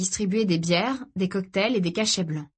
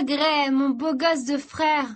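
high, slow, doting croon; high-on-ecstasy affection; dreamy sister; 7 semitones higher, much slower than usual. Acted. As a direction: draw it out lovingly.

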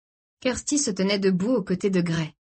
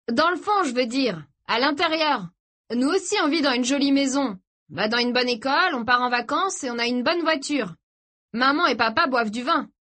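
higher-pitched, earnest character voice; brother arguing his case; 6 semitones higher than usual. Acted. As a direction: make your case in a raised pitch. (frère qui plaide sa cause, voix plus aiguë)

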